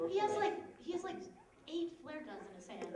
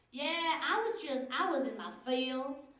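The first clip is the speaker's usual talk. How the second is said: put-on Southern accent; 3 dB louder than usual. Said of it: "I don't know why they had southern accents".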